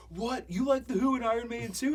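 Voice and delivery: high excited mock voice. Guitarist mimics his friend's excited squeal, high and loud. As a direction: ham up a middle-schooler thrilled by shared taste. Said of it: "He's like".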